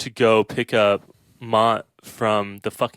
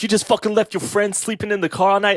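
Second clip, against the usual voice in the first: put-on higher New York cop accent, incredulous; 10 semitones higher than usual. Cop scolding him for leaving his friend: New York accent. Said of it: "I like your New York accent".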